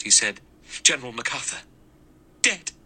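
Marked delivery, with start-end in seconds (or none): 0.8-1.6 higher, breathless call; out of breath. Acted. Armstrong bursting in after running, higher and breathless. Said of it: "his breath coming fast". 2.4-2.7 much higher, shocked cry; explosive outburst. Acted. Vera shocked by the news, a much higher burst. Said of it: "The word burst from Vera explosively".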